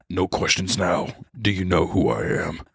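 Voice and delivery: low menacing kingpin; Heisenberg voice. Retold or imitated. Walter threatening the student, low. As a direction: growl it like a drug lord.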